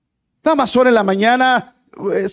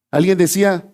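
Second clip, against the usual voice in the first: ordinary talk, nothing marked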